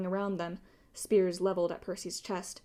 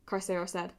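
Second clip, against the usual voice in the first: usual speaking voice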